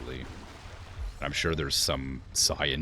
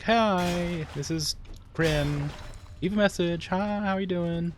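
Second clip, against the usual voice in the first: high, bright-toned phone answering voice; phone greeting voice. Shown as a demonstration. Streamer shows viewers her phone-answering voice, high. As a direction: answer the phone sweetly, pitched well up. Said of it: "like this when I answer my phone".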